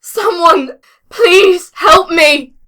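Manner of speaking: high, loud, drawn-out shouting; desperate plea for help. Acted. Injured woman to anyone within earshot, pleading shout. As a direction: scream for someone to come and save you. Calling out for rescue.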